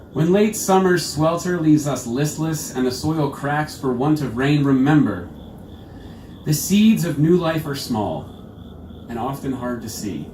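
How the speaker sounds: measured, projected poem recital; poetry reading. Acted. Poet to a live audience, measured poetry reading. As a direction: read the verse out with deliberate phrasing.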